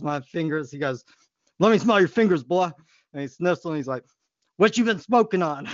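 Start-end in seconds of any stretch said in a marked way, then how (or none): 1.6-2.7 stern principal. Retold or imitated. Principal voiced. 4.6-5.7 stern principal. Retold or imitated. Principal voiced.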